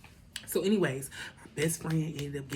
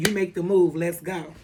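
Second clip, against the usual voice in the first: much louder, daring quote of friend; loud tough challenge; 2 semitones higher, 10 dB louder than usual. Retold or imitated. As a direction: bark it like ready to fight. Friend ready to fight.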